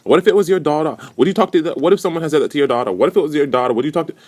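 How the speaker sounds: high-pitched, hammed-up indignant imitation; mocking repeated outrage. Housemate pressing her daughter argument, mocked in high voice. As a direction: parody her harping on her big point. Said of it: "she kept saying that shit over again".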